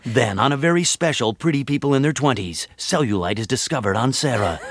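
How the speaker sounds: mock-solemn promo, dropping at the end; TV promo announcer. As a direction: lean on THEN like a network announcer. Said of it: "it's all about the word then".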